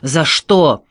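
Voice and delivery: short, indignant acted yell; hurt cry. A narrator's reading. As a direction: cry out as if unjustly hit. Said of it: "крикнул".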